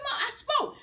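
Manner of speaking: high-pitched, laughing protest to mom; childish protest. Retold or imitated. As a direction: whine it up high like a kid caught out. Pleading with mom after being hit.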